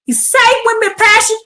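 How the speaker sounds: fervent preaching cry; passionate. Real, not acted. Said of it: "I'm doing it with passion".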